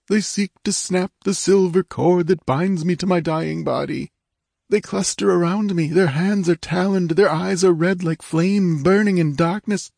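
faint ghostly plea. Acted. King's lost soul begs his sister, high and unearthly. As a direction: beg for help from a distant void. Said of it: "his voice came faintly and from far away".

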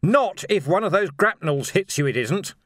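high, loud, urgent argument; shouted retort. A narrator's reading. Vimes argues nose to nose, shouting. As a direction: shout down the objection.

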